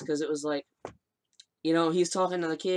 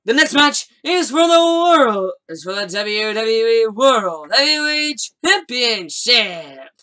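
booming, drawn-out ring announcer intro; 4 semitones higher, 10 dB louder, slower than usual. Pretend. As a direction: stretch every name out, big and loud. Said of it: "in my announcer voice".

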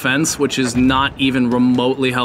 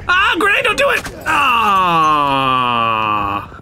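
panicked scream; 3 semitones higher, much slower than usual. Real, not acted. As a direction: scream at a live grenade. Grenade about to kill him.

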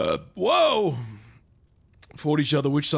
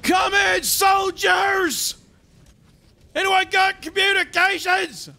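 barking army sergeant, high and loud; drawn-out, raised battlefield holler; Sarge; 4 dB louder, slower than usual. Acted. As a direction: shout it like a sergeant under fire. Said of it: "Sarge is actually just one of them".

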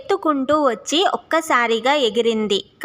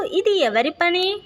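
puzzled animal voice; 6 semitones higher than usual. Acted. Animals ask who did it.

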